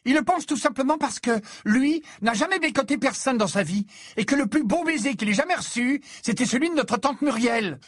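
high, heated taunting; shrieked-up mocking outburst; angry tirade. A narrator's reading. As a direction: rail at him with scorn. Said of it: "en s'emportant cette fois contre Harry".

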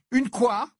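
high, rising challenge; sharp high-pitched question; outraged cry. A narrator's reading. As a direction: cut in with an indignant cry. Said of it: "s'écria Ginny".

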